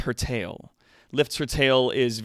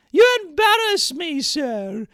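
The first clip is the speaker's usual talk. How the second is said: prim maid voice; 9 dB louder than usual. Acted. Streamer reading the maid's lines, high falsetto. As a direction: squeak it out like a coy, flustered maid. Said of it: "I'm playing both the characters".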